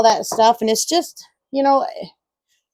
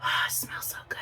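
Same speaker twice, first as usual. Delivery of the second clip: whispered; 13 dB quieter, faster than usual. Real, not acted. Smelling the squash.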